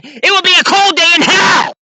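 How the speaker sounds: loud, high furious declaration; fired-up angry yell; angry shout. Real, not acted. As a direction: slam it down like a vow. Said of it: "Excited with anger".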